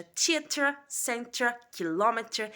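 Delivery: slow British "theater", no final r; British accent. Shown as a demonstration. Teaching students, British-accent "kilometer". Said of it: "presta atenção que dessa vez vai mudar a pronúncia".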